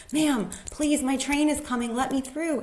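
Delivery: urgent plea to the train attendant; pleading. Retold or imitated. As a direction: beg the attendant to let you through.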